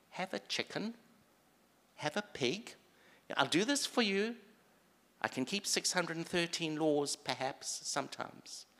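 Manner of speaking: high-pitched haggling with God; hammed-up, high wheedling offer; mock bargainer. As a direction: pipe up eagerly like someone offering a deal.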